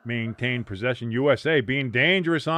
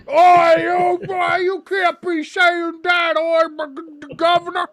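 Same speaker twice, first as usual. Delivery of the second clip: bad, high, loud mock British accent, slow; shouting in a hammed-up British accent, dragged out; drunk British accent; 7 dB louder, slower than usual. Mocking an upset European fan in a loud, high bad British accent. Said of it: "the worse the accent becomes".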